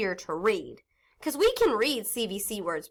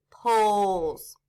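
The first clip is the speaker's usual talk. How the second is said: usual speaking voice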